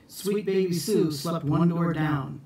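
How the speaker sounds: ordinary delivery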